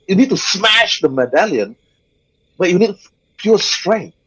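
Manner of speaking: ordinary talk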